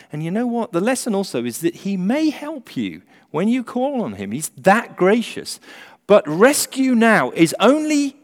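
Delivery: fiery, urgent preaching; doomsday street preacher. Acted. Preaching at full force.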